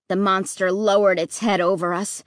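ordinary delivery, nothing marked

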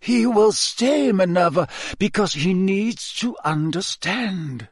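high, slow, insistent command; curt. A narrator's reading. Dumbledore overrules McGonagall about Harry, curt. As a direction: overrule firmly, weighting each word. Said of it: "said Dumbledore curtly".

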